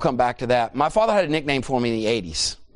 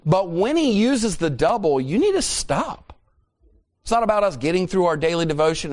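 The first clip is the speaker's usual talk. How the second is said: fervent preaching; 5 semitones higher than usual. Acted. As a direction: press the point home to the congregation. Preaching at full force.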